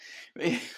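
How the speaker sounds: a quiet rueful laugh; a soft laugh at her own exhaustion; short tired laugh. Real, not acted. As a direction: laugh it off wearily.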